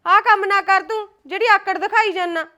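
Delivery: loud, insistent motherly lecture; stern scolding. Acted. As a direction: scold your son firmly. Mother scolds her stubborn son.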